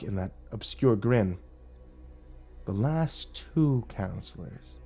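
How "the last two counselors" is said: sinister whisper. Acted. Sinister Mike voice.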